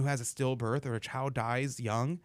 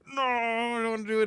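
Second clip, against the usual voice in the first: whiny complaint; 10 semitones higher, 3 dB louder than usual. Retold or imitated. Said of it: "I'm, like, complaining the entire time".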